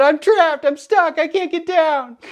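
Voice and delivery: high-pitched mock distress call. Stranded hiker answering the Coast Guard, mock calling out.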